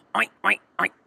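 put-on boy's playful oinking; pig oinks. A narrator's reading. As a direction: snort like a pig for fun. Boy imitating a pig.

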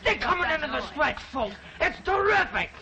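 excited, high-pitched play-by-play; race commentary. Acted. Boy calling an imaginary horse race for the gang, excited commentary. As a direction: call the race to the crowd at full tilt.

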